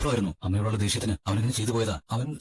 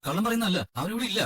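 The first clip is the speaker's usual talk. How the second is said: high, anxious protest; urgent pleading; 8 semitones higher than usual. Acted. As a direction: plead with rising pitch as you protest. Pleading with police searching the house.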